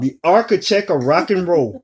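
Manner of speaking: high, showy rock and roll title; reaching for note. Acted. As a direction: go up high, not quite hitting the note. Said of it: "try to hit that note".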